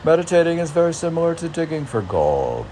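high, loud, fast quote reading; a reader relishing a spiritual line; reading quote aloud. Shown as a demonstration. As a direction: announce the line brightly and quickly. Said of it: "reading a quoted line aloud".

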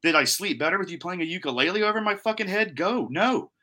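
high-pitched incredulous outrage; retold snapping. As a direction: throw his excuse back at him, exasperated. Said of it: "I snap a couple times".

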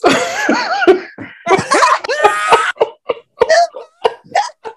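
high shrieking cackle; shrieking laugh. Real, not acted. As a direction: burst into a screaming laugh.

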